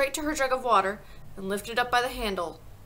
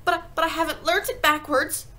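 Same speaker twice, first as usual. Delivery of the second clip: high, anxious schoolboy protest; loud, high-pitched flustered excuse; stammering; 8 semitones higher, 5 dB louder than usual. A narrator's reading. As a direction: stumble at the start, pleading. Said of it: "stammered Wilfred".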